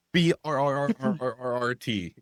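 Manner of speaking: gun brrrt noise. Retold or imitated. Imitating the A-10 gun sound.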